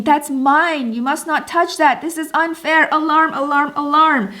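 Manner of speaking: high-pitched, indignant alarm, re-enacted; mock inner alarm. Wife's inner thoughts as husband takes her thing, mock alarm. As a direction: sound the alarm over an injustice. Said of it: "in my mind, I'm thinking".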